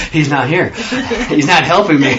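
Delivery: chuckling through the words; laughing. Real, not acted. Teacher jokes to the class about the missing editor, laughing. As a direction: laugh it off while talking.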